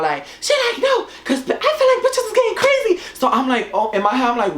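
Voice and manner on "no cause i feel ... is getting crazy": squeaky high imitation of a tipsy friend, laughing; high mock friend voice. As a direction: pitch it way up and giggle through it. Mimics her friend, laughing.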